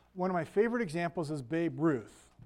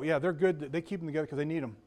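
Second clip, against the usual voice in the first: normal talking voice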